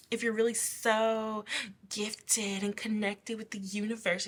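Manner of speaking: ordinary talk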